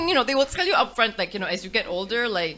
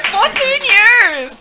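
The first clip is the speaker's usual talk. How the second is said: excited shout; 13 semitones higher, 10 dB louder, much slower than usual. Real, not acted. Cheering the veteran podcasters.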